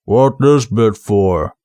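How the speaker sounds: low, slow troll voice; slow low rumble of a dim troll. Acted. Detritus rumbling into the armorer's ear, low and slow.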